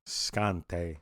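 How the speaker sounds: "Scante" said slow and soft, "skahn-tay"; imitating his pronunciation. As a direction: mimic how he says "Scante", drawn out and quiet. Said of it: "I don't like the way he says it".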